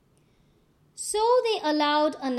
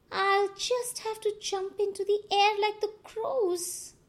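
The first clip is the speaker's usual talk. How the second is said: higher, lightly voiced spider; Anansi's voice; 8 semitones higher than usual. A narrator's reading. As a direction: talk yourself into a plan. Anansi talks to himself.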